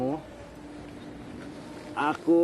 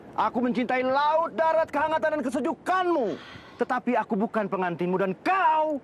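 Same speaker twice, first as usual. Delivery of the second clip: much higher, soaring stage declamation; hammy stage actor; 8 semitones higher, slower than usual. Shown as a demonstration. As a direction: overact it like a melodrama. Hammed-up dramatic recital.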